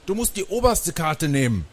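high, insistent objection; calling out in protest. A narrator's reading. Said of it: "rief ich".

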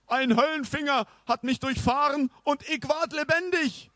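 put-on high, pompous old knight. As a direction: announce yourself like a grand knight. Knight tells his tale.

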